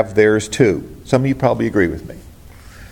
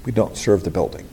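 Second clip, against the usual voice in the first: softer, lower repeat of "We don't serve the building"; hushed, lower restatement; quiet echo; 5 semitones lower, 6 dB quieter than usual. Shown as a demonstration. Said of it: "but we don't serve the building".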